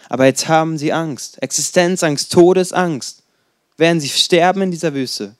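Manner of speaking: ordinary delivery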